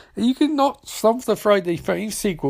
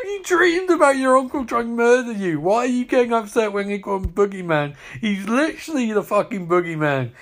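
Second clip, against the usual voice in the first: normal talking voice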